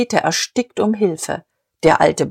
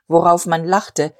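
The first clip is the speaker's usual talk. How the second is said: ordinary delivery, nothing marked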